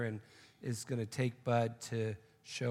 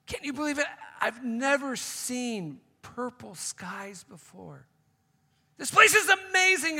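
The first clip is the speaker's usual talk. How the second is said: amazed exclaiming; 10 semitones higher, 8 dB louder than usual. Acted. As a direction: gush with wonder. Awestruck Bud again.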